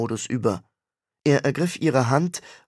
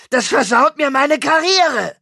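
much higher, much louder, slow roar; bellowing; 13 semitones higher, 7 dB louder than usual. Acted. Rock star roaring at his brother, slow furious bellow. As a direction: roar it out in frustration. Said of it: "brüllte er".

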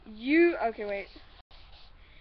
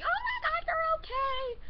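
high squealed relief, much higher; mock relieved squeal. Schoolgirl greeting a friend after the storm, high squealing relief.

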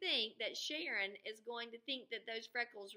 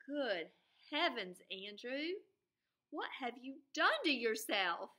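shocked teacher; 4 semitones higher, 6 dB louder than usual. Acted. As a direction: gasp it out in disbelief. Teacher shocked at blue dots.

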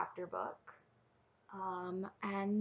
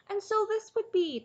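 high, louder, eager argument; urging; 14 semitones higher, 7 dB louder than usual. A narrator's reading. Taryn arguing with Cole for sword practice, urging. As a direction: press the point, pitched high. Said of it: "Taryn urged".

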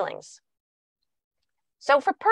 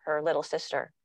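ordinary delivery

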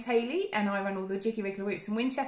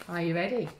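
plain reading voice

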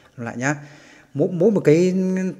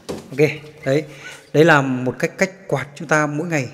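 ordinary talk

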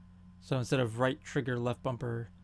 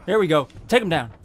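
ordinary talk, nothing marked

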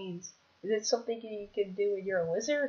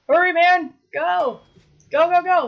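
high, loud commands; urgent shouting; 9 semitones higher, 11 dB louder than usual. Real, not acted. Player urging a teammate on, urgent shout. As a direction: yell to rush them along.

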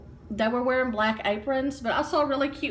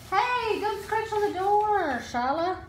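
high, slow sing-song scolding of the dog; calling out to dog; 10 semitones higher, slower than usual. Real, not acted. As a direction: call the dog off the door, pitched way up.

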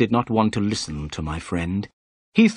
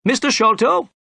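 high, loud formal address; pompous inspector, stern; 5 dB louder than usual. Acted. Inspector addressing his suspect, high and officious.

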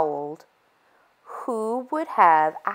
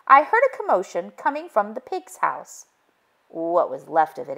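ordinary delivery

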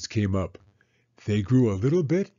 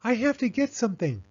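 put-on high child's voice, anxious questions; Penny worrying to Mama, high and anxious; worried little girl; 12 semitones higher than usual. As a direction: fret like a small girl who fears the worst. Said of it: "she said".

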